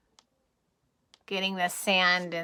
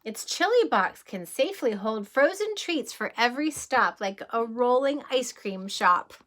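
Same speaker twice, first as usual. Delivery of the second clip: lively rhyming read-aloud, a little louder; storybook reading; 3 semitones higher, 5 dB louder than usual. Acted. Reading a bedtime train book to children, lively read-aloud. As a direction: bounce through the rhyme for the kids.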